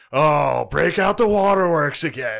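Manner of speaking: loud, high, slow sarcastic yell; mock exasperated shout. Boyfriend fed up with girlfriend's tears, mock exasperated shout. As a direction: bellow it in hammed-up exasperation.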